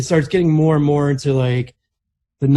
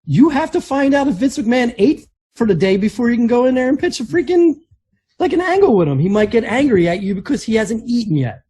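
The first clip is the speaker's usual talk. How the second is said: lightly voiced, higher retelling; half-hearted Jericho quote, pitched up; slightly raised storyteller quote; a hint of Jericho; 8 semitones higher than usual. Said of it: "Jericho explained".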